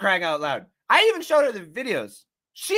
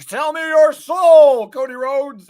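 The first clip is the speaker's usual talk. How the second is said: slow, loud put-on Rock voice, pitched up; The Rock's menacing demand; 6 semitones higher, 9 dB louder, slower than usual. A streamer imitates The Rock taunting Cody Rhodes, slow mock villain voice. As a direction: drag it out like a menacing wrestling promo.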